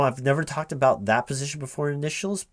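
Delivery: normal talking voice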